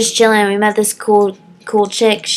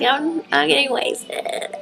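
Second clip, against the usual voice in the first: tipsy mock boast; 6 semitones higher, 6 dB quieter, slower than usual. Acts out her tipsy cheer.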